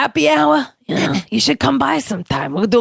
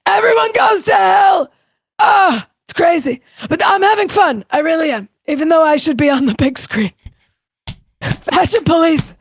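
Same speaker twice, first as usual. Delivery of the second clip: Joan Rivers impression; 5 semitones higher than usual. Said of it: "Joan Rivers on Nikki Glazer's performance".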